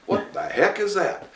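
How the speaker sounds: a driver gaping at a giant ship out of nowhere; startled driver. Retold or imitated. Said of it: "they're hitting their brakes like".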